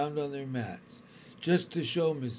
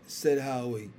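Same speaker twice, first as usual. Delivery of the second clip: ordinary delivery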